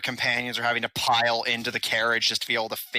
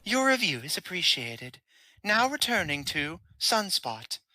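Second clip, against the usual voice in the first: higher, polite machine announcer; automated voice; 7 semitones higher than usual. Acted. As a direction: speak as a cheerful elevator system. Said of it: "the automated voice".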